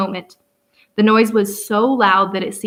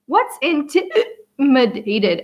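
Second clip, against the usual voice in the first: put-on higher, slow, childlike puzzled ask; little girl's question; 4 semitones higher, much slower than usual. A narrator's reading. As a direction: voice a small girl asking a hard word. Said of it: "asked Lindy".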